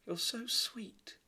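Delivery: very high, slow sweet princess; a light, high little-girl princess. Acted. Princess to the tiny mouse, high and sweet. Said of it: "said the princess".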